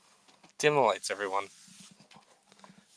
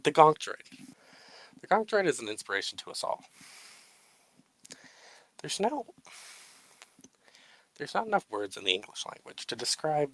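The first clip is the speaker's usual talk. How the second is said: mock solemn tribute. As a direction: give a grand, mock-serious speech.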